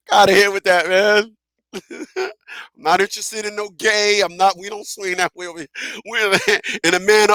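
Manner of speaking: normal talking voice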